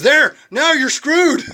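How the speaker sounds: loud, high, drawn-out punchline; exasperated shout. Acted. Jogger having thrown her in the ocean, exasperated shout. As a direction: yell it slowly in mock triumph and annoyance.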